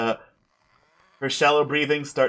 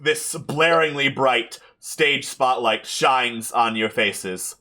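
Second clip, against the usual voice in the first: ordinary delivery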